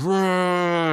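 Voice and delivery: high, stretched mock groan; slow-motion hit cry. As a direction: imitate a slow-mo roar after taking a hit. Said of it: "he gets hit and he goes".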